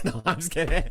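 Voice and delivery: laughing it off. Real, not acted. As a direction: giggle through the retraction. Laughs off his own joke.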